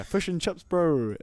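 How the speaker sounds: imitating a New Zealander, New Zealand accent; mock New Zealand accent. Mocking Kiwis in New Zealand, New Zealand accent. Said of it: "people like".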